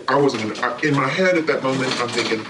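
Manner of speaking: ordinary talk, nothing marked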